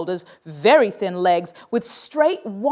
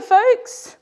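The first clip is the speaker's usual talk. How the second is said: usual speaking voice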